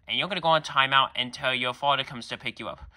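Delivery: normal talking voice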